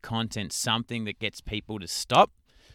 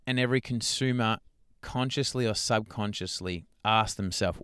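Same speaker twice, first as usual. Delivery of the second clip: ordinary delivery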